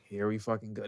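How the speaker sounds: mock weary remark under the breath; resigned aside. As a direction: drop it right down, as if bracing for trouble. Acting the pack's resigned reaction.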